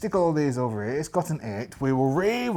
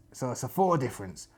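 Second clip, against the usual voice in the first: ordinary talk, nothing marked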